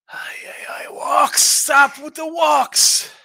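real frustrated groan-shout; frustrated outburst. Real, not acted.

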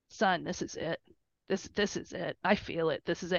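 soft, low retold confiding; hushed certainty. Parent retelling her words to her son, hushed certainty. Retelling a heartfelt decision moment.

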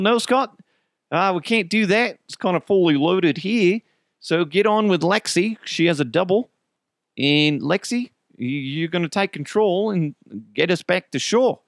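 kayak rental guy. Retold or imitated. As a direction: give instructions as the local boatman. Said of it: "the guy that sold us the kayaks".

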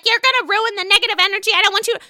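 high, fast whiny mock voice; mock whiny Scheana. Mocking Scheana at the pool party, high and whiny. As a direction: whine like a spoiled bride. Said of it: "She's like".

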